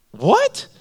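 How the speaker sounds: high-pitched stunned question; retold disbelief. Writer hears his broke friend tithes, acted incredulous. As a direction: shoot right up, acting out a baffled friend.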